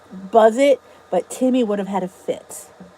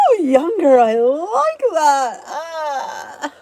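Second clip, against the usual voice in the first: delighted gasp; 5 semitones higher, much slower than usual. Real, not acted. Viewer compliments, delighted reaction.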